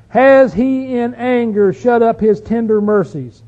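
loud, slow rhetorical question; ringing, drawn-out Bible reading; scripture reading. Acted. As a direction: proclaim the psalmist's question to the congregation. Reads psalm's questions aloud.

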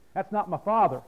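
normal talking voice

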